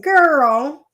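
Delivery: put-on grandma greeting the grandchild with a loud, rising "Girl"; grandma's "Girl". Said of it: "That's how she would say it".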